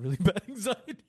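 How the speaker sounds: retold verdict cracking into a laugh; amused, laugh bubbling through the words; breaking into laughter. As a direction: start laughing midway through the quote. Cracks up retelling doctor's verdict.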